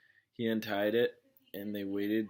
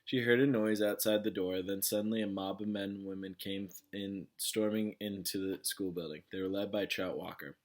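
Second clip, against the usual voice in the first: plain reading voice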